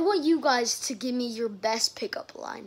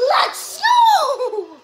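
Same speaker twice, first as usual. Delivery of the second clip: high, loud, drawn-out cheer; triumphant shout; 12 semitones higher, 10 dB louder than usual. Real, not acted. Player three runs up cheering in the game, triumphant shout. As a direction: belt it out in celebration.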